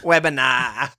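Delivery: put-on Australian accent. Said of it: "say with an Australian accent".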